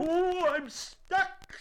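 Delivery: high, loud, drawn-out wail; distressed bear. Acted. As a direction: cry out slowly as the stuck bear. Pooh stuck and upset.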